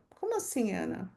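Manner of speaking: quiet, quick interjection in a listener's place; puzzled aside. Acted. Listener interrupts Ana to question her claim, puzzled aside. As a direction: drop the volume for an imagined question.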